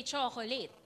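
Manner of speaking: chocolate as "cho-ko-layt"; soft chocolate with full vowels; Filipino-style chocolate. Shown as a demonstration. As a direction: sound every syllable of chocolate. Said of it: "use the Filipino rule".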